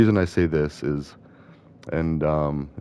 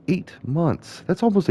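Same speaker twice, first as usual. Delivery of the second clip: disbelief; 12 semitones higher than usual. Real, not acted. Disbelief at the layoff pay.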